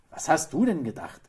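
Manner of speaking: loud, high, firm retort; emphatic woman's rhetorical question. A narrator's reading. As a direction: answer back decisively. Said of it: "sagte die Frau wieder bestimmt".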